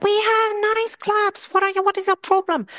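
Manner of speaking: indignant retort. Retold or imitated. Lecturer answering exchange students, high indignant retort. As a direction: defend your town's clubs, outraged. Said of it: "I said".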